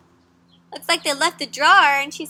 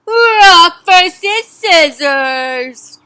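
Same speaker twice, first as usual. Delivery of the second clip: very slow, loud drawn-out announcement; fight announcer; 2 semitones higher, 9 dB louder, much slower than usual. Acted. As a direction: boom it out like a ring announcer. Announcing the matchup.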